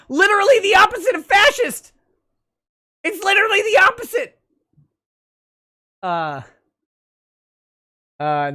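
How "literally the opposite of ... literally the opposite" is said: high, loud exasperated yell; outraged shout. Real, not acted. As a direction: shout it in disbelief. Outraged at the fascist remark.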